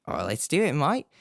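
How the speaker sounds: playful bogan Australian twang; jokey bogan-style Australian accent. Pretend. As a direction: slip into broad Aussie. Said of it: "The accent".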